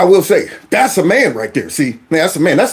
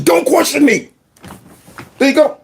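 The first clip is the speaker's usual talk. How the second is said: mock snapping; 7 semitones higher than usual. Player snaps at coach.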